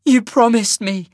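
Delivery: high, loud tearful protest; tearful reproach. A narrator's reading. Sasha reminding Cole of his promise, tearfully. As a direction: accuse him through tears. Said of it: "tears in her eyes now".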